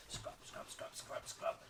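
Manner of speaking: breathy whispered scrub chant; whispered scrubbing. Shown as a demonstration. Teacher whispering the scrubbing during the game. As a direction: whisper each scrub as you work.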